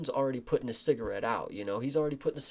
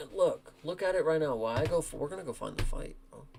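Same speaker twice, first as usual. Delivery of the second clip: ordinary delivery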